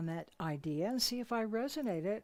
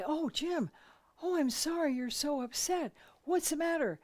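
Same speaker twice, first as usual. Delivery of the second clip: higher, solicitous wife fussing over him; wife soothing her upset husband, higher and sweet; sympathetic fussing; 6 semitones higher than usual. Retold or imitated. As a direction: coo concern at the upset husband. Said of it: "And I would say".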